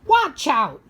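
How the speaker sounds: loud, high cry of warning; shouted warning. Acted. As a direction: call out sharply to warn. Story character calling out warning.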